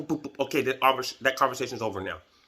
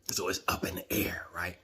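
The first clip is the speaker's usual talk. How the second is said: whisper; 5 semitones lower, 6 dB quieter than usual. Real, not acted. Secretive aside about yard politics.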